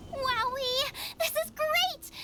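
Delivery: high, bright burst of joy; excited. Acted. Xiangling squeals at the fresh game, thrilled. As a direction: squeal over the pile of meat. Xiangling sees the hunted meat.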